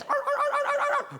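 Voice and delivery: high yappy dog barks; dog barking. Acted. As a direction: bark like eager hounds. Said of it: "they went like this".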